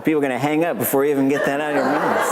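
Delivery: fast, laughing through it; quick amused joke. Real, not acted. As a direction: rush the joke out while laughing.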